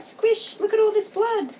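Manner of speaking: high, breathy mock dramatic voice; high, theatrical look at the blood; mocking squeamish person. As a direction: ham up a squeamish friend. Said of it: "they're like".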